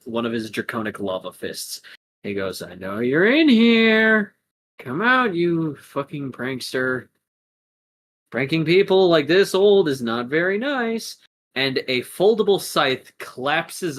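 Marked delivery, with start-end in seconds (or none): 2.6-11.2 raised, singled-out challenge; calling out prankster. Acted. Hero calls out hidden prankster.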